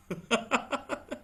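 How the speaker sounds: soft, high chuckle; laugh. Real, not acted. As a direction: laugh lightly after your own remark. Se ríe de su propio consejo. (risa suave y aguda)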